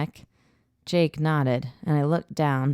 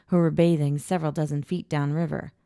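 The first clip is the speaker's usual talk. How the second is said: ordinary delivery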